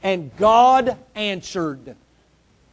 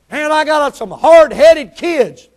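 louder, higher, slow exasperated groan; exasperated parent; 6 semitones higher, 8 dB louder, slower than usual. Retold or imitated. Preacher voicing a tired parent's complaint, exasperated.

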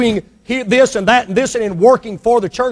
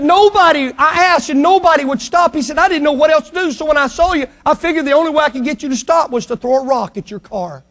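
put-on higher, desperate child's plea; boy pleading with the executive, higher and urgent; 8 semitones higher than usual. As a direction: explain through tears, mister. Crying boy explains to man.

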